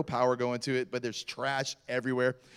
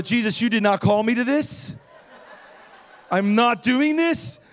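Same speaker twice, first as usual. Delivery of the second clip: loud, raised-pitch mock refusal; indignant protest; 6 semitones higher, 7 dB louder than usual. Pastor balks to Jesus over roaches, mock-indignant.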